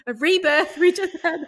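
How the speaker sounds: high, loud laughing disbelief; amazed, laughing. Retold or imitated. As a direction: exclaim it through laughter, as if calling for witnesses. Laughing retelling of her amazed reaction.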